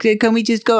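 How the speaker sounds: high-pitched child imitation; pestering little daughter. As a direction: imitate a kid nagging to leave. Retold small daughter pestering.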